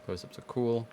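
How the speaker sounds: ordinary talk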